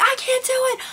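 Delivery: high-pitched retold wail; distressed protest. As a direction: act out a desperate, squealed refusal. Retelling being too sick to swallow.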